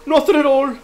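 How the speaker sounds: loud high shout of glee; shouted punchline. Real, not acted. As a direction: yell it out, delighted.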